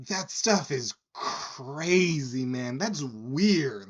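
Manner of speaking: high, loud, stretched-out mock amazement; amazed bar patron. Drunk patron marvels at an octopus, imitated amazement. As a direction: drag out "crazy" like an awestruck drunk guy. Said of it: "This man turns to me and goes".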